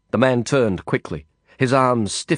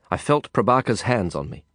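ordinary delivery

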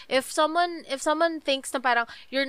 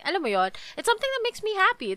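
ordinary talk, nothing marked

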